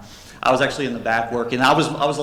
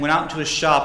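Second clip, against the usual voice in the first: normal talking voice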